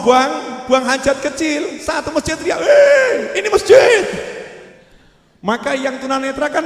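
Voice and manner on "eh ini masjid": much higher, loud outraged shout; alarmed shout. Retold or imitated. Congregation yelling at the blind man peeing, loud outraged shout. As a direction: yell it across the mosque.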